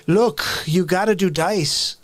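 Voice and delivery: put-on New York accent, slow and emphatic; Dice Clay accent. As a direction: push out the New York swagger. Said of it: "my New York is basically like Andrew Dice Clay".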